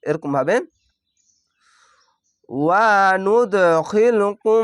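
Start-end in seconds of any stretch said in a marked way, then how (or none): 2.5-4.7 ওয়া নুদখিলকুম chanted slowly with a bouncing দ; recitation with qalqala. Shown as a demonstration. Teacher reciting for students learning idgham. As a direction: echo the দ in নুদখিলকুম. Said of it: "এখানে কলকলা হবে".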